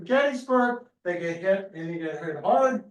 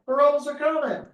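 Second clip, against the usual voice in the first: mock alarm; 6 semitones higher, 3 dB louder than usual. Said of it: "People cry".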